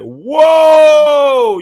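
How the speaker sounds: a loud, high startled whoa; shocked whoa. Retold or imitated. Friends hear he was stabbed, shocked loud whoa. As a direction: let it burst out, high and loud.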